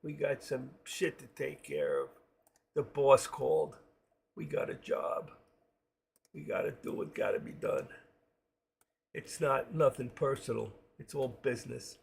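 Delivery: hushed mob-movie tough guy; wise guy. Acted. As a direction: play a wise guy quietly giving orders. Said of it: "I love the way they talk".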